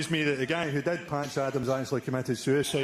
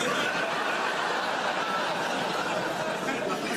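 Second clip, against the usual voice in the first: breathless high laugh; real wheezing laugh; 7 semitones higher than usual. Real, not acted. Laughing at the Belfast punchline, breathless.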